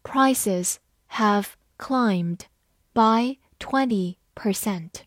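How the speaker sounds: slow, word by word; measured teacher's model reading. Shown as a demonstration. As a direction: read slowly with pauses between words. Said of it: "慢读一遍".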